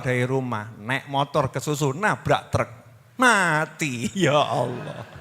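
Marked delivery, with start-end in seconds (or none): none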